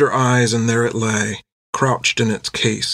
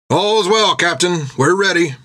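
sailor voice; 6 semitones higher than usual. Acted. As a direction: report smartly to the captain. Sailor reports to captain.

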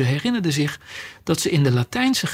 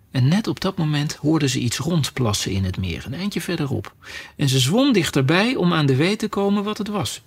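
usual speaking voice

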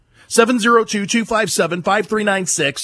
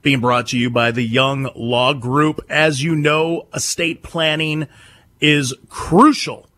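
lower, measured sponsor read; 7 semitones lower than usual. Acted. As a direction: sell the law firm calmly, like a radio spot. Reads a sponsor ad.

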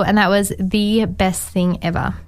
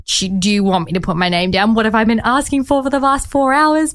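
ranting; 3 semitones higher than usual. Retold or imitated. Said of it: "I started ranting".

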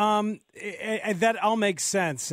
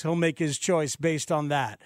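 usual speaking voice

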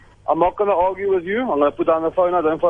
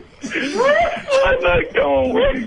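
laughing; much slower than usual. Real, not acted. Cracking up at the prank.

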